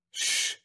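sharp hushing hiss; shushing. Acted. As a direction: hush them with a long shh. General hushes his companion.

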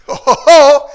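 loud, rising "oh, oh, oh"; impressed whoop. Real, not acted. Coach cheering fast pupils, loud whoop.

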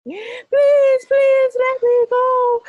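high, loud crying pleading; crying plea. Acted. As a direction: beg through tears. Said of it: "George started to cry and cry".

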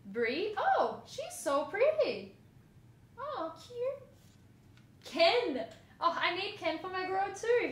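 high, loud delighted gushing; delighted collector gushing over cards; excited gushing. Real, not acted. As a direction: coo with real delight.

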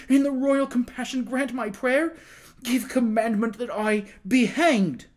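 high, loud desperate plea; terrified imploring. A narrator's reading. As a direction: beg the king for mercy in broken, frantic phrases. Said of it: "appealing imploringly to the king in broken and terrified phrases".

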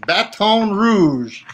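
Baton Rouge drawn out slowly, with a nasal French "Baton"; a slow, French-flavoured Baton Rouge; French-style Baton Rouge. Shown as a demonstration. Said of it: "It's the French that's coming out in me".

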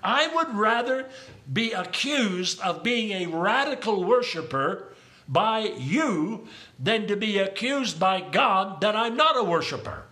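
ordinary delivery

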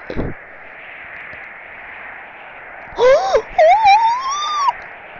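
high, wailing scream after a gasp; loud drawn-out shriek of shock. Real, not acted. Player startled by the game, high shriek.

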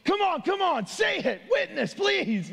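high-pitched pleading cheer, drawn out at the end; imagined onlookers rooting desperately; angels urging. Pretend. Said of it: "I could just see the angels".

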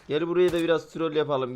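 ordinary talk

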